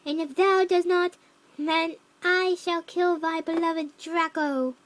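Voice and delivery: loud, slow, portentous threat; villain's threat. Acted. Voldemort threatening to kill her beloved, menacing declamation. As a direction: intone it like an evil overlord.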